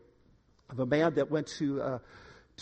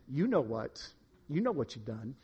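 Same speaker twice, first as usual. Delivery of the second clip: low, soft insinuation; lightly voiced devil, quietly reminding someone of guilt; devil's voice; 5 semitones lower, 6 dB quieter than usual. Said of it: "And we hear that voice".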